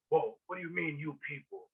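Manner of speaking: quiet, pulled-back "what do you mean, you people?" trailing off; a touch of a taken-aback man, voiced softly; offended character. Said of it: "And he's like".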